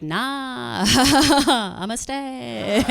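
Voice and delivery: very slow, drawn-out lazy refusal; creepy lingering guy. Retold or imitated. The yoga student telling Liz he will stay, in a very slow creepy drawl. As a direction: drag it out like you're not going anywhere.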